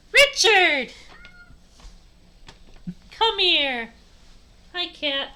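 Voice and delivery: high, loud call to a pet. Real, not acted. Streamer calls the cat over, calling out. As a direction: sing out the cat's name to summon it.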